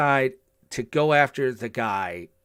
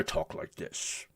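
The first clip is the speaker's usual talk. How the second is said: lower, slower Klingon voice, dropping into the role; Michael Dorn doing Worf, pitched down and slowed; deep Worf voice; 8 semitones lower, 13 dB quieter, slower than usual. Retold or imitated. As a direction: imitate Worf's low, slowed delivery. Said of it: "he had this deeper timbre".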